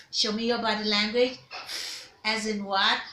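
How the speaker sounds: ordinary talk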